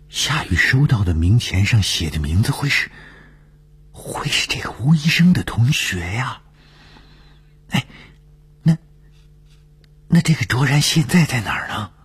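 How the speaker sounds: high-pitched, swooping voice of disbelief; astonished colleague. A narrator's reading. Dongfeng asks the narrator where Zhuoran is, astonished. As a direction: push the questions out high, in amazement.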